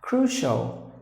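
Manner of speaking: "Crucial" ending like shall, KROO-shul. Shown as a demonstration. Teacher models "Crucial" with a shall ending. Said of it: "pronounced like shall".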